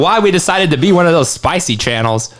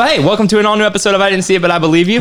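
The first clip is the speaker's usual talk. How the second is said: show intro; 4 semitones higher, much faster than usual. Acted. Show intro announcer read.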